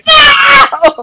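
high-pitched mock wail of protest; exasperated outcry. Yelling at the crying film character, exasperated. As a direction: cry out high in disbelief.

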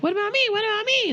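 put-on very high, pleading child voice; whining child imitation. Other child begging parents for attention, high mock pleading. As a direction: pipe up high like a neglected child.

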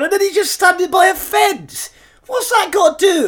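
very high, loud baffled outburst; loud disbelief. Real, not acted. Podcaster baffled by a photo, incredulous shout. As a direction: exclaim in comic outrage.

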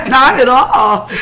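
slow, laughing denial. Real, not acted. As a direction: drag it out, giggling. Laughing denial of being tired.

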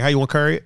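"curry" in place of carry, Baltimore style. Shown as a demonstration. Showing a Baltimore phrase to friends, carry pronounced curry. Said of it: "carry is curry".

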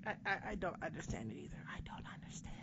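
a breathless whisper, quick and hushed; whispered confusion. Real, not acted. Admitting to her co-host she's lost, whispered. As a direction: drop to a whisper, as if baffled. Confused aside after awkward pause.

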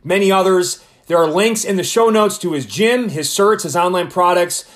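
loud, punchy announcer delivery; podcast intro read. Acted. Host introducing his boxing coach to listeners, upbeat announcer read. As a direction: sell the guest to the listeners.

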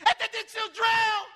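high, loud mock panic; panicked shout. Preacher imitating people who react to bad news, panicked shout. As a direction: cry out as if disaster struck.

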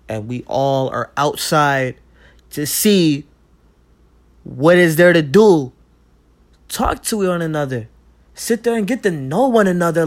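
loud, emphatic, rising in pitch; real impassioned shout. Real, not acted. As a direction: call it out forcefully to a crowd. Urging everyone outside to talk.

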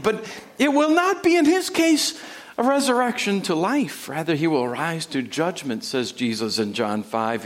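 ordinary talk

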